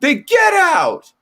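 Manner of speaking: mocking a stilted line, high and slow; mock-imitating an actor's flat line delivery, stretched and high; badly delivered line. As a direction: parody the worst line in the film, drawn out and high. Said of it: "instead we got".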